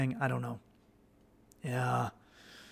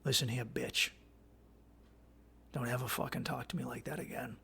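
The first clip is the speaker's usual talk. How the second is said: put-on a calm stern boyfriend setting a firm limit; stern warning; 2 semitones lower, 7 dB quieter than usual. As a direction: lay down the law quietly, no yelling. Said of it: "you look at her very sternly".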